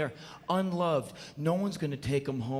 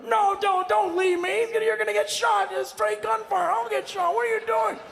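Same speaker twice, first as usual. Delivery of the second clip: much higher, whining terrified pleading; mock-frightened begging to flee; panicked friend; 6 dB louder than usual. Adam begs his friend to leave the party, high panicked whine.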